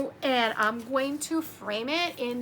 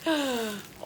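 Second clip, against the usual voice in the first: gasp. Real, not acted. Opening a surprise package.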